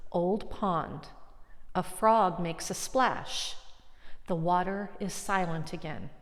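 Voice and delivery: poem reading. Shown as a demonstration. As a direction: let each image of the poem settle. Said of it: "then I'm gonna do the translation".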